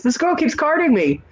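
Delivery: high-pitched imitation of a complaining customer; mock complaint. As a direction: tattle to the boss in a high voice. Mayor complains to the owner.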